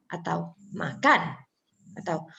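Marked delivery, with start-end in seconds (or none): 0.7-1.5 MAKAN slow, weight on the final KAN; stressed last syllable. Shown as a demonstration. Teacher showing alternative stress, slow with weighted ending. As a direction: slowly say makan, leaning on KAN. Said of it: "menyebutnya harus".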